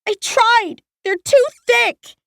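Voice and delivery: put-on high, slow, tearful girl; a trapped girl giving up; frightened girl. A narrator's reading. Girl voiced in story.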